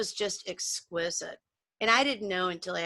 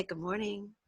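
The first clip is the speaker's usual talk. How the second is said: hushed, tender good morning; a mother's gentle morning greeting; soft lovely voice; 9 dB quieter than usual. Retold or imitated. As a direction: say it softly like waking a child. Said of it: "in this lovely voice of hers".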